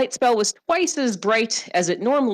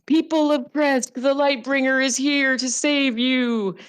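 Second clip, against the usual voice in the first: preacher shout; 7 semitones higher than usual. Retold or imitated. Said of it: "he started yelling out".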